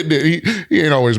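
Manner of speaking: normal talking voice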